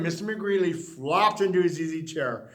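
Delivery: plain reading voice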